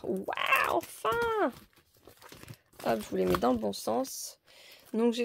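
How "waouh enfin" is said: high-pitched, drawn-out mock amazement; hammed-up "at last!" delight; acted wow. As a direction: exclaim it in a put-on high voice. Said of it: "j'ai envie de dire".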